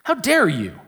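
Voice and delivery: strong rebuke. Acted. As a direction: repeat the reproach with acted outrage. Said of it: "very strong".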